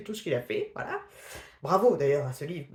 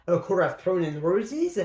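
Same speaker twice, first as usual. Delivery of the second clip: showy English accent, louder and slow; self-mocking English accent; 5 dB louder, slower than usual. Shown as a demonstration. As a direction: put on your best English accent from French, proudly overdone. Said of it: "avec mon super accent".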